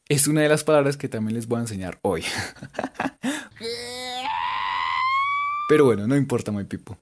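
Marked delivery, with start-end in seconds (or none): 2.3-5.6 a high burst of laughter. Real, not acted. Laughing at saying hoy too often. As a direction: laugh out at yourself.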